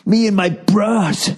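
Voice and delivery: high, slow bro drawl; mock bro voice. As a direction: play a smug dude bro. Imitating bros.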